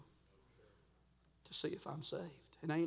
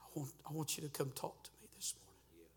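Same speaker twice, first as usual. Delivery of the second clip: soft, hushed call from the Spirit; still small voice; 9 dB quieter than usual. Acted. Preacher voices the Holy Spirit calling him to talk, hushed. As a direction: speak it softly, as an inner prompting. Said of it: "the Holy Spirit said".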